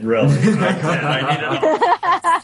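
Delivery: slow and high, through laughter; laughing. Real, not acted. Actor laughing as he admits to the panel he needed help.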